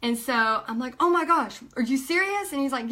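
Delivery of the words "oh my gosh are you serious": high-pitched acted astonishment; gasp-free, high burst of disbelief at good news; astonished. Astonished reply on the phone.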